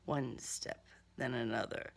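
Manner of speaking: soft, low, hushed; hushed refrain. A narrator's reading. Narrator tracking the killer's steps, hushed. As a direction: murmur each step under your breath.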